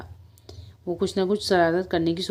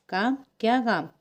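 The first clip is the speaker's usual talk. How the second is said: higher-pitched, curt questioning; a touch of irritation; mildly angry; 4 semitones higher than usual. A narrator's reading. Tailor questions the mouse, mildly angry. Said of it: "दर्जी ने गुस्से से पूछा".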